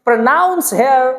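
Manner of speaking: ordinary talk, nothing marked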